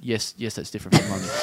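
reluctant woman. Retold or imitated. Cornered woman answering the tram weirdo, low restrained voice. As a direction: imitate a weary stranger answering low. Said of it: "the woman is like".

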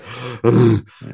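exaggerated wheezing gasps; mock winded gasping. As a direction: imitate someone badly out of breath. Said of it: "still in shape and doesn't go".

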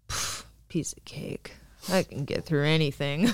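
breathy, dismissive brag; cocky breathy scoff. Retold or imitated. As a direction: scoff it off, unimpressed. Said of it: "afterwards I was like".

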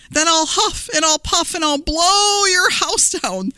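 high storybook wolf threat, no real laugh; lightly voiced wolf, taunting; gleeful wolf. A narrator's reading. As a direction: raise the pitch and draw out the threat playfully. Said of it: "laughed the wolf".

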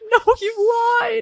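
put-on high, tearful shout of a hurt kid; crying child. Child locked in the bathroom cries back at mom. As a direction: wail it through tears at the one who lied.

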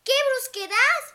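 high, indignant unicorn; offended unicorn. Acted. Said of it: "dijo el unicornio". (unicornio ofendido)

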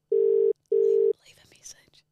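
breathy whispered aside. Pretend. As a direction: whisper it like a secret. Whispered voicemail joke.

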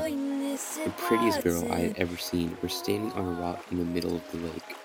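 ordinary delivery

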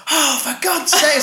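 high, slow exasperated mum; annoyed mum. Retold or imitated. Mum annoyed at the fake proposal, high exasperated groan. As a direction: drag it out, fed up. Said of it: "really loud".

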